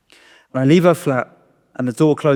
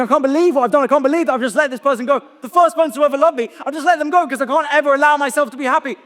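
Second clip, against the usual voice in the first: raging; 11 semitones higher, faster than usual. Retold or imitated. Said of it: "And I'm raging".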